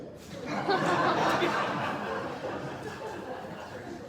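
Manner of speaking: high airy laugh; real amused laugh; breathy laugh. Real, not acted.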